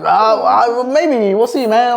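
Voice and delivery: mock unsure, high and loud; hedging with a hammed-up nervous laugh; hesitant reply. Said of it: "I was like".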